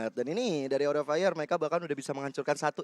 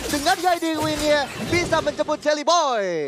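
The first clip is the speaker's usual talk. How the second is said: much higher, loud, fast hype call; excited play-by-play; 12 semitones higher, 7 dB louder than usual. Real, not acted. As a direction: rave about the damage at full pitch. Hype cast of big damage.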